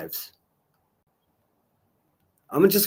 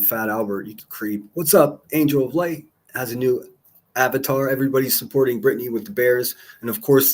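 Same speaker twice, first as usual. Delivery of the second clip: ordinary talk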